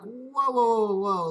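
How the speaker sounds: loud, high startled protest; retold shock, voice jumping up; alarmed whoa. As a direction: stop them cold, alarmed. Seller names a wrong address.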